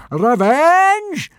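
huge high bellow. A narrator's reading. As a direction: roar it in fury. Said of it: "bellowed Gobber".

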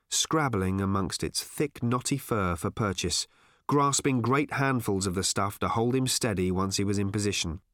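ordinary delivery

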